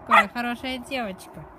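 loud, high praise; praising a dog. Real, not acted.

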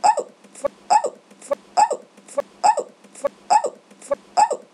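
squeaky high cracked yelps; voice cracking. Real, not acted. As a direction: voice breaks up into a squeal. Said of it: "The voice cracks".

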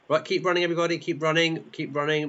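rally the pack as you run; urging runners on. Pretend. Urging runners on during race.

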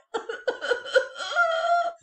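breaking into a high laugh; storyteller cracking up. Real, not acted. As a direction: laugh out loud. Narrator laughs at crying.

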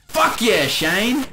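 high, loud shout Fuck yeah Shane; loud cheer. Retold or imitated. Cheering Shane on at the top of the lungs. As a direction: yell it like a stadium fan.

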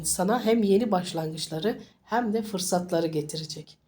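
ordinary talk

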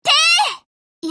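high, loud "てー!" shouted fire order; sharp shouted fire command; battle cry. Acted.

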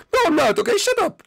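high, loud retort; indignant denial. Acted. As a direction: shout the teasing down, pitch way up. Angrily denies being like dad.

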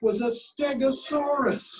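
plain reading voice

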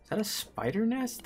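sudden discovery, checking; surprised question. Real, not acted. Spots nest.

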